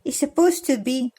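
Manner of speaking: ordinary delivery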